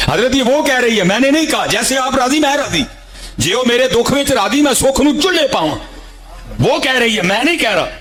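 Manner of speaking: high, earnest plea of a heartbroken disciple; pleading disciple. Acted. Heartbroken disciple begging his master, high and pleading.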